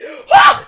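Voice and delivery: sharp frightened gasp; startled gasp. Real, not acted. Sparkler flares up.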